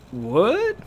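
high, loud, rising question; drunken disbelief. Acted. Drunk girl stunned by friend's news, high rising blurt. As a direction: shoot the voice upward in shock.

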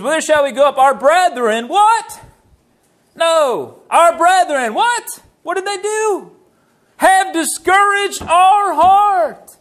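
high, rising preacher's call and response; forceful preaching. Acted. Preacher quizzing congregation on the verse, forceful preaching. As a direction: drive the verse home, pitch climbing to a slow shouted finish.

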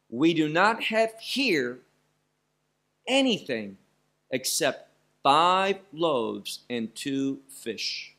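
slow weighty reading with "not" stressed; emphasized. Shown as a demonstration. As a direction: stretch "except five loaves and two fish" slowly. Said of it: "repeat again in also an emphasized way".

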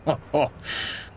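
airless haughty scoff; unvoiced dismissive chuckle; breathy scoffing laugh. A narrator's reading. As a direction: scoff without voice. Gordon scoffs at old coaches.

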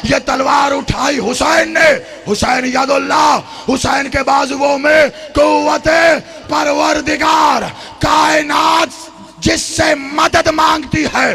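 full-force preaching. Acted. Orator praising Hasan, Husain and Ali, preached at full force.

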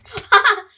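sudden loud high laugh; burst of laughter. Real, not acted. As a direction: explode into a laugh. Surprise laugh at game chaos.

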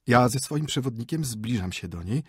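ordinary delivery

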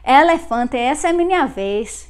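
punchy Northeast Brazilian accent, open vowels. Shown as a demonstration. Teacher repeating how northeasterners say it, open Northeast Brazilian accent. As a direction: hit "Elefante" loud with open Northeast Brazilian e's. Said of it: "They would pronounce like that".

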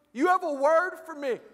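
high, expectant female voice; mock-earnest young lady; excited young woman. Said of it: "She goes".

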